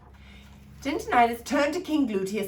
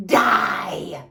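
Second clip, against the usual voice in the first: loud barked snap; angry fairy snapping a curse; snapped shout; 4 semitones lower, 9 dB louder than usual. Acted. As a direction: bite the word off hard. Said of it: "snapped Bibo".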